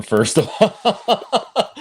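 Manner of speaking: laughing high through the words. Real, not acted. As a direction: giggles break the answer.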